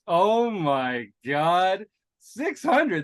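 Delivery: hammed-up wonder at the number; mock awe. Host mock-marvels at six hundred episodes, hammed-up squeal.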